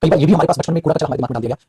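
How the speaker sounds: ordinary delivery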